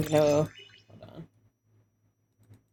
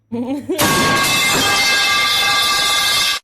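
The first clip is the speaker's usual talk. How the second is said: loud breathless wheeze laugh; wheezing laugh; 7 dB louder than usual. Real, not acted. Huge unvoiced laugh burst.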